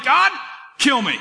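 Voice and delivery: high, loud mock cry to God; despairing plea. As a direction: cry out "God" like a desperate prophet, hammed up. Acting out Elijah's despairing prayer.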